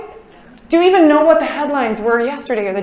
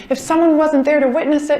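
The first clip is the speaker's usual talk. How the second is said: normal talking voice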